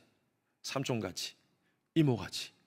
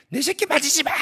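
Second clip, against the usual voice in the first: retold scream; 11 semitones higher, 4 dB louder than usual. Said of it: "소리를 지르대요".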